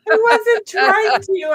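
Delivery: high, drawn-out wailing protest; mock whine. Real, not acted. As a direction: whine it out in fake hurt. Repeats her mock protest.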